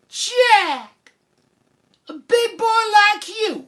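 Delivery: very high, loud exasperated mom; scolding mother. Acted. Mother scolding Jack over wet pants, exasperated. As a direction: screech at your son in mock dismay.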